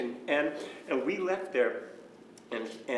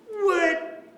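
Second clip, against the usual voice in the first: high, loud indignant mock outburst; imitating angry dad; 7 dB louder than usual. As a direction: retell dad's incensed outburst, pitched way up. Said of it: "what are you so mad about".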